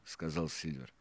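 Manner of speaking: usual speaking voice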